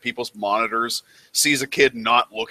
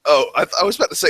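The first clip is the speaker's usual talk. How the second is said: ordinary delivery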